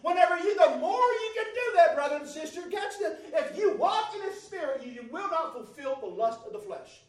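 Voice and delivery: loud, high, fervent preaching; shouting the sermon up to a climax; full-force preaching. Real, not acted. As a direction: preach it out with rising fire.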